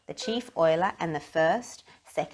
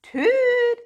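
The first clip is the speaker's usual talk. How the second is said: high, loud booming toot back; echoing shout; 10 dB louder than usual. A narrator's reading. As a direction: shout it like an echo from a barrel. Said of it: "shouted the barrel".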